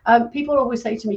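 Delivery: usual speaking voice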